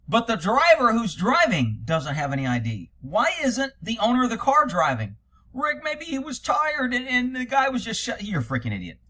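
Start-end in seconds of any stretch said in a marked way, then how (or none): 5.5-8.2 high whiny voice mocking the critics. Mocking the critics who defend the driver, whiny. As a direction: pipe up like a naive defender.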